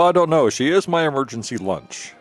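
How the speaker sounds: usual speaking voice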